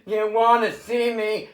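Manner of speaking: ordinary delivery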